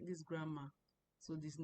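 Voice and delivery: ordinary delivery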